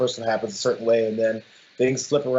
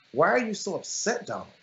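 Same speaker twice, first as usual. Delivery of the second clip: higher, needling mock sympathy; patronizing taunt; 7 semitones higher than usual. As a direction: poke at his ego like a grown-up scolding a child. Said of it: "like, patronizing".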